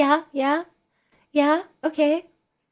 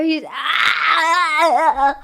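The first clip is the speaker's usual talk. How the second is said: long strained "aah" of exasperation; frustrated scream; 4 dB louder than usual. Real, not acted. Streamer fed up with chat's dumb bit, frustrated scream. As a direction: let the frustration burst into a drawn-out cry. Frustration boils over at chat.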